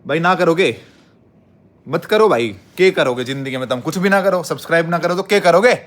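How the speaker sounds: loud, high-pitched rant at the viewers; mock anger. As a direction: scold them in hammed-up exasperation. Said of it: "गुस्सा ही दिखाता हूँ तुम्हें".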